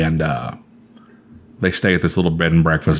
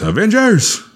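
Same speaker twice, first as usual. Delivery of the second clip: Cap's rallying shout. Retold or imitated. Cap calls his new team in the facility, heroic shout. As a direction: belt it out like a hero calling the team. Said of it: "and Cap goes".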